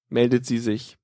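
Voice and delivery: plain reading voice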